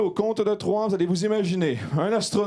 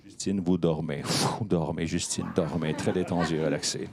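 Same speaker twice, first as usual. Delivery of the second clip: soothing hypnotist drone; hypnotic command; 10 semitones lower, 4 dB quieter than usual. Acted. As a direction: lull her to sleep with a low voice. Hypnotist putting subject to sleep.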